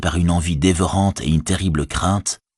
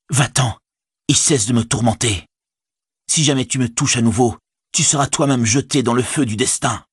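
stern higher command; authoritative voice; 6 semitones higher than usual. Acted. Frodo, in the vision, commands Gollum sternly. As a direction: pronounce a doom from within the flames. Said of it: "Une voix autoritaire".

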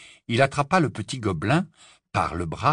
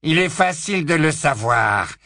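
Lucius Malfoy; 7 semitones higher, 6 dB louder than usual. A narrator's reading. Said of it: "intervint la voix de Lucius Malfoy".

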